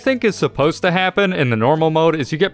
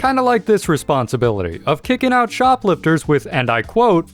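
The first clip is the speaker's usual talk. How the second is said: ordinary talk, nothing marked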